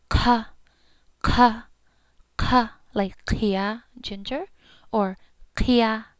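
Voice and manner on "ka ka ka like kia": ka with a puff of air, kia drawn out slowly; aspirated ka. Shown as a demonstration. Teacher showing learners the breathy ka. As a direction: blow air out with each ka. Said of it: "just blow air with it".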